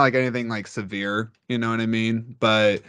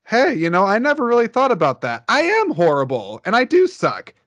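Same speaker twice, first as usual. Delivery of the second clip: sarcastic meek driver, much higher and louder; mock polite apology; 7 semitones higher, 3 dB louder than usual. Pretend driver thanks him for the insult, high sarcastic voice. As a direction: play the driver apologizing absurdly sweetly. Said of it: "do you think they're just gonna be like".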